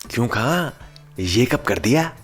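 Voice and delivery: loud, high startled demand; alarmed shout. Acted. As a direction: blurt it out in alarm. Imran confronts drunk friend.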